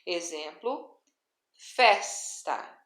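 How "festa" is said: "festa" with a strong, held FES; teacher's FES-ta demo of "festa"; stress on next-to-last syllable. Shown as a demonstration. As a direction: punch the first syllable of "festa", let TA drop. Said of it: "tem como sílaba tônica a penúltima".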